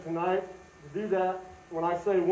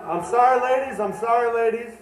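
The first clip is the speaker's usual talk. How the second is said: mock apology; 5 semitones higher, 5 dB louder than usual. Mock apology to women in audience.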